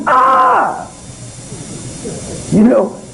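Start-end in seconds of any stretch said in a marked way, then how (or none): 0.1-0.9 sudden high yelp of alarm; a short, sharp, high-pitched cry; mock scream. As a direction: let out a startled high "Ah!". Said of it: "and scream".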